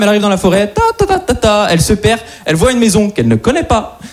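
ordinary talk

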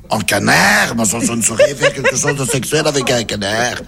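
put-on Belgian tourist accent; astonished question in a broad Belgian accent. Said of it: "un Belge qui arrive à Paris".

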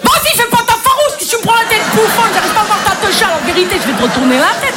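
put-on ill-fitting street accent. New gynaecologist scolding a shy patient, ill-fitting street accent. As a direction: hustle the patient in mock street slang accent. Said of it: "des accents qui vont pas du tout avec certains jobs".

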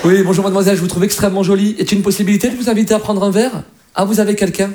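ordinary talk, nothing marked

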